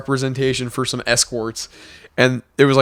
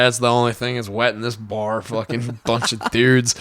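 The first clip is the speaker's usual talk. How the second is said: put-on drunk insult; 4 semitones lower, 5 dB quieter than usual. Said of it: "I was like".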